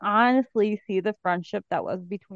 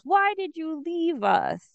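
high, drawn-out mock lament; mock plaintive; 5 semitones higher, slower than usual. [name] on the couch asking why he was left, mock plaintive. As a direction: draw it out like a wounded plea.

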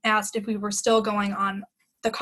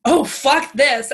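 retold fury; 4 semitones higher, 7 dB louder, slower than usual. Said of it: "I was livid".

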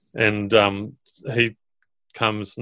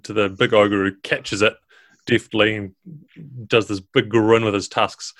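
ordinary talk, nothing marked

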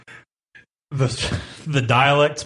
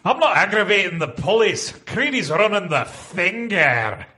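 Scottish read; 5 semitones higher than usual. Acted. Reading Scottish dialogue aloud.

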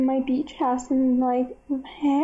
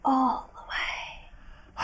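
whispered; 6 dB quieter, much slower than usual. Pretend. Repeating joke line dramatically.

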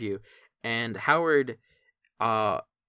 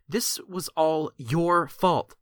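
higher, louder accusing scold; 6 semitones higher, 5 dB louder than usual. Acted. Mandy blaming Papa Scuff for the split, scolding. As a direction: blame him, pointing a finger. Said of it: "she points a finger ... she says".

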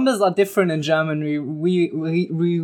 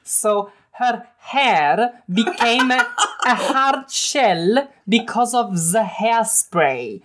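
slow, higher mock Italian accent; 3 semitones higher than usual. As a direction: lean into a hammy Italian accent. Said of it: "I can also do Italian".

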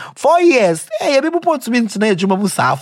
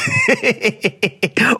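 real laughter, rising; amused laugh; 5 semitones higher than usual. Real, not acted. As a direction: laugh out at the letter. Laughs at reading the letter.

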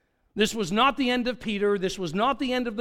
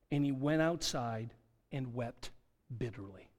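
ordinary delivery

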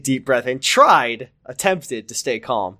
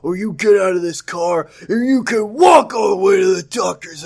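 shouting Ricky; 4 semitones higher than usual. Acted. Ricky shouting at Amy about seatbelt. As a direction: yell it like a furious husband. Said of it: "he shouted".

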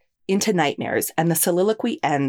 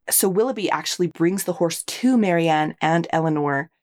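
ordinary delivery

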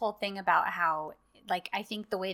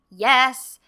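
a loud, eager yes; thrilled burst of yes; excited yes; 4 semitones higher, 12 dB louder than usual. Retold or imitated. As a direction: cheer it at the screen. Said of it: "which I was like".

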